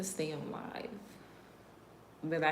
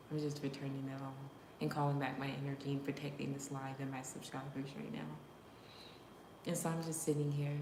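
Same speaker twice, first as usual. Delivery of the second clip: normal talking voice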